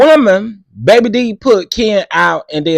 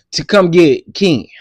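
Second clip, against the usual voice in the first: usual speaking voice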